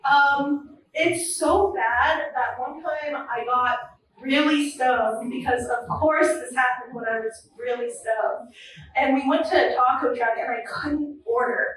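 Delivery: normal talking voice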